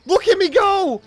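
high, loud, thrilled yell; gleeful high shout; excited yell. Real, not acted. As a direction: cheer yourself on as the boat takes off. Excited at boat game start.

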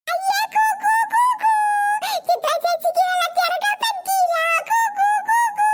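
put-on high childish taunting hoots; mocking jeer. Child teasing Chitra for being kept from the water, mocking.

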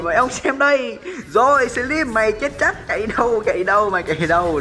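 high, pumped-up jeering; excited taunting chase. Real, not acted. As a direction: taunt the fleeing enemy. Chasing an enemy, taunting.

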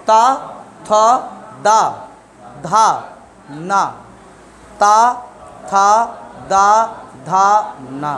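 ता, थ, दा, धा, ना said one by one with tongue on teeth; dental consonant drill. Shown as a demonstration. Teacher drilling students on dental ता थ दा धा ना. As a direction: drill each dental sound crisply. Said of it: "दांत पे टकराना चाहिए".

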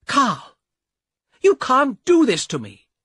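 high, loud furious cry at her brother; angry shout. Acted. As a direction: yell it at him, high and loud. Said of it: "shouted at the man".

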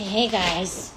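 soft, sleepy put-on pet voice; hushed, halting talk as the dog; speaking for dog. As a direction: voice the reluctant dog quietly.

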